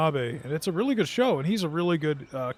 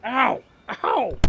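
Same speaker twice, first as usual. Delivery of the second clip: pained yelp; 6 semitones higher than usual. Real, not acted. Sudden pain at the keyboard.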